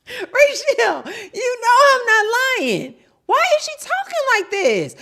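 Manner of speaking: very high, loud squeal; shrieking in comic exasperation; exasperated protest. Real, not acted. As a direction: wail it at a laughing friend. Protesting to laughing Rachel.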